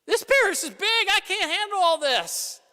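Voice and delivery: high, loud desperate pleading; overwhelmed priest crying out to God; frantic prayer. Retold or imitated. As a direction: throw the panic at God. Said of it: "I've said that a lot to God".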